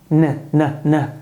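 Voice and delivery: hummed "n" with tongue on palate; just the n, held and short; isolated n sound. Shown as a demonstration. Teacher isolates the final n for students, hummed. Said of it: "como se eu fosse começar a soar a letra N".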